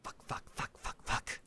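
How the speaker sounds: under-the-breath string of curses; whispered cursing. Acted. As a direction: hiss the curses to yourself. NPC realizes delivery burned.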